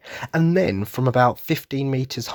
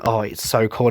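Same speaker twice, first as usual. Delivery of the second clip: ordinary delivery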